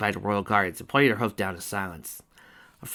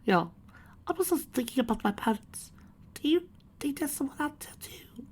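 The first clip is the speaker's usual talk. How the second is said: put-on high young boy voice, half whispered; little colt voice.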